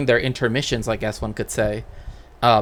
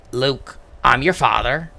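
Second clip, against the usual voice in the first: Darth Vader line in a light West Country accent; a hint of a West Country burr on the famous line. Shown as a demonstration. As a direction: say it like a Devon farmer, mildly. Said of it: "That's how it would have sounded like".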